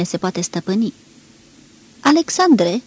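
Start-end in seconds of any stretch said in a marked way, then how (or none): 2.0-2.8 higher, louder call across the room; lady hails Alexandru. A narrator's reading. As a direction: call his name out eagerly. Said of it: "strigă din nou doamna".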